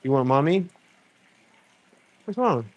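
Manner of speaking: high gentle comforting voice. Real, not acted. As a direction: soothe the little one. Parent comforts crying child.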